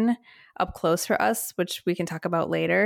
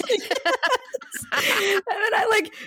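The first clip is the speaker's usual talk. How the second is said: high, breathless laughter through words; giggling through embarrassment; laughing hard; 13 semitones higher, much slower than usual. Real, not acted. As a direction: laugh as you speak.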